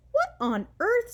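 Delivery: high, baffled exclamation; be puzzled by the question; bewildered. A narrator's reading. Puzzled mom.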